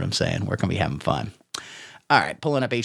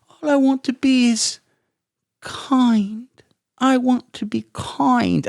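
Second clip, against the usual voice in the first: put-on much higher, slow and sweetly earnest child voice; mock a syrupy, too-sweet little boy; cloying little boy; 9 semitones higher, slower than usual. Mocking the cloying boy from the animated short, sugary and slow.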